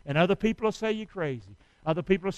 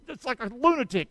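ordinary talk

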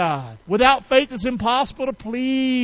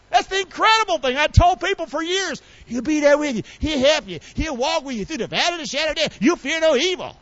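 high-pitched, fervent preaching; impassioned preaching; 7 semitones higher than usual. Real, not acted. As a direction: pour out the promise with rising fervor. Impassioned testimony of God's presence.

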